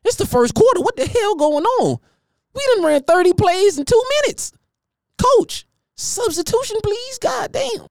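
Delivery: high, breathless player begging for a sub; exhausted player impression. Lineman wiped out in the first quarter, begging for a sub, high and breathless. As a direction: squeak it out like a gassed lineman pleading with the coach. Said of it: "My boy said".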